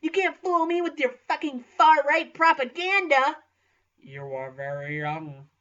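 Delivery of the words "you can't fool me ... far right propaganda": high, loud outraged yell; indignant shout. Acted. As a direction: shout it at the professor. Acted outraged student rant.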